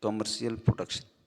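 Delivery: slow, careful reading of an English definition. Shown as a demonstration. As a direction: read it out word by word for the class. Reads English definition slowly. (परिभाषा धीरे-धीरे पढ़कर सुनाना)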